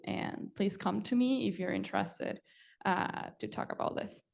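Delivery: usual speaking voice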